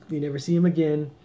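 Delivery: normal talking voice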